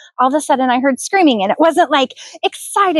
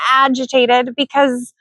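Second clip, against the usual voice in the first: ordinary delivery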